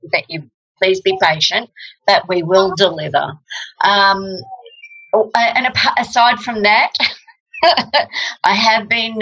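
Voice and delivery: ordinary talk, nothing marked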